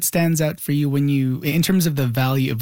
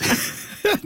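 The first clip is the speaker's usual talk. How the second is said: a quick airy laugh, pitched way up; high breathy laugh. Real, not acted. Host laughs at the thought of an hour-long chat, a high airy laugh. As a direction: let a light laugh slip out, high and breathless.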